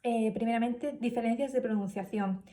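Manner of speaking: ordinary delivery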